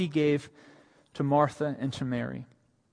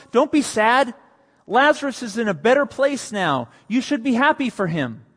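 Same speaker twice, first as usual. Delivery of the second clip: mock platitude; 8 semitones higher, 7 dB louder than usual.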